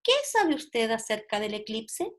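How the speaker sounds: "¿Qué sabe usted acerca del eclipse?" said slowly as a clear question; teacher modelling question intonation slowly. Shown as a demonstration. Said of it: "tengo que usar una entonación distinta".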